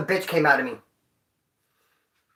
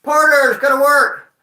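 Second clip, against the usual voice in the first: loud, higher guard call; cop announcing; 6 semitones higher, 6 dB louder, slower than usual. Retold or imitated. The guard opens the closet and calls the porters, loud. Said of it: "the cop came in".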